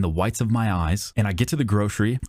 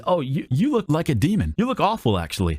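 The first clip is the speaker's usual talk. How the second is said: higher-pitched mock cashier voice; mocking imitation; 6 semitones higher than usual. Cashier insulting a freshly tanned customer, mocking imitation. Mimics rude cashier in hypothetical scene.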